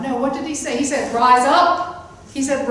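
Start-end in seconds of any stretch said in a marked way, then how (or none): 1.1-2.1 loud, rising command to rise up; commanding call. Acted. Jesus calling the dead man to rise, commanding. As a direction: call him up off the bed with authority.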